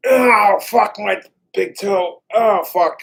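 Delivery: real loud cry of pain, slow and drawn out; pained yell. Real, not acted. Stubbed big toe, yelling out loud in real pain.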